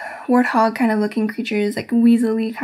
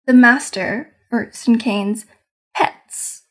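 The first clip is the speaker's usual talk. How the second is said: ordinary delivery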